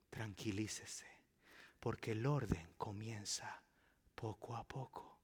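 soft, low hush; calm them down gently; quiet soothing pastor. Acted. Pastor calming advice.

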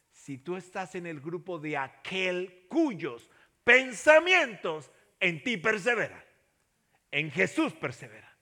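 loud, slow, emphatic preaching; preaching at full force. Acted. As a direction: drive the promise home. Preaching climax.

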